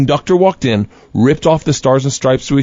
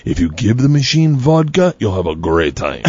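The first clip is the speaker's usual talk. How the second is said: slow, low-pitched Igor voice; imitated slow, solemn mobster; gangster introduction; 8 semitones lower, slower than usual. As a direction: present him gravely, unhurried.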